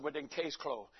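low, quiet finality; mock-authoritative retold reply. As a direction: drop down to end the discussion. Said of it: "my voice got more deeper".

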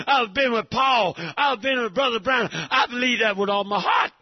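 loud, driving sermon cadence; fervent preacher shouting; full-force preaching. Acted. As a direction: preach it hard to the congregation.

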